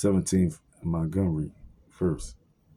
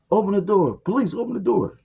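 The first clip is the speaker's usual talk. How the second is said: much higher, louder barked police order; mock police shout; 14 semitones higher, 4 dB louder than usual. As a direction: play the cop yelling through the door. Police banging at the door.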